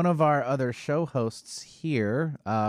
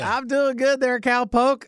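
higher, louder cowboy twang; Southern cowboy accent; 12 semitones higher, 6 dB louder, slower than usual. Pretend. Producer answers host in mock Southern cowboy accent. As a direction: play along as a cowpoke. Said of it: "You wanna go with that?".

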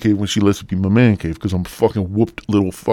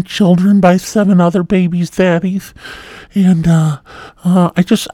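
loud, high put-on woman voice; hammed-up parody in a high, loud voice; mock Tinder woman; 8 semitones higher, 4 dB louder than usual. Single mother on Tinder seeking a provider, mocking.

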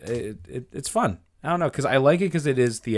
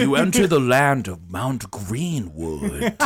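game master narration; 9 semitones higher, 5 dB louder than usual. Pretend. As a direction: set the scene like a DM, giggling.

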